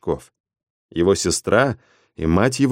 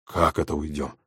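gasping; 6 semitones lower, 9 dB quieter than usual. Acted. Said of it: "ахнул кругосчёт".

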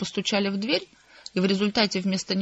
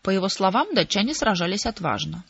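usual speaking voice

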